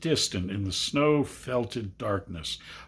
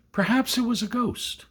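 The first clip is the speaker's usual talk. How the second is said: spooked; 12 semitones higher than usual. Acted. Scared boy suggests ghost.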